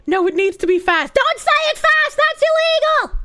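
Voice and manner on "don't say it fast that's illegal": high-pitched mock outrage; hammed-up protest. Streamer objecting to saying it fast, high mock outrage. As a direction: squeal it as an exaggerated objection.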